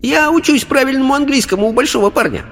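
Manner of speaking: put-on very high, thin little-girl falsetto; ringing child voice. A narrator's reading. Spirit girl greeting the circle, ringing child voice. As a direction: ring out high like a tiny girl. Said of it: "Голос был очень тонким и высоким".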